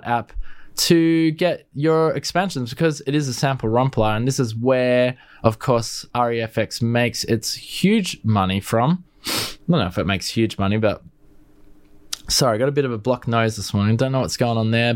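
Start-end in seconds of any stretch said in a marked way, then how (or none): none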